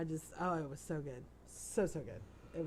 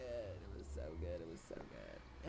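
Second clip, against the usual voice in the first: savoring low murmur; 13 semitones lower, 10 dB quieter than usual. Real, not acted. Savoring remembered shrimp dinner.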